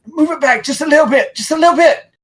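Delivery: high, panicked pleading; scared prisoner. Acted. Scared young prisoner begging with a dagger at his throat. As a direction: squeak out a frightened plea. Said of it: "And he's like".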